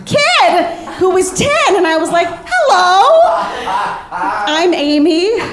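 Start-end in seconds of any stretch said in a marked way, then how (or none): 2.3-5.4 put-on high, sing-song drunk greeting; drunk friendly chatter. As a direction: chirp brightly at the kid. Drunk chat with a child.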